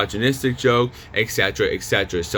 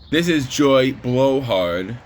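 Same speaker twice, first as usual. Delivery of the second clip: ordinary talk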